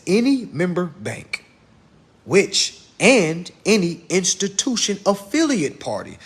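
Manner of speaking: breaking it down. Shown as a demonstration. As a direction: spell it out phrase by phrase, pausing. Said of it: "I gotta break this down in layman's terms".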